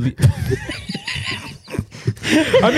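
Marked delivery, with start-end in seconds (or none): 0.2-1.5 sudden high shrieking laugh. Real, not acted. S0 bursts out at "sucked off.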